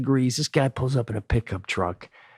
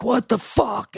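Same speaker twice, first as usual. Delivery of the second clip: shocked outburst; 12 semitones higher, slower than usual. Retold or imitated. Panicked outburst at blown tire.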